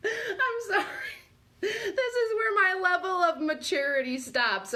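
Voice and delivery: high, tearful laughing voice; real laughter under every word; helpless giggly apology. Real, not acted. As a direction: confess while still cracking up.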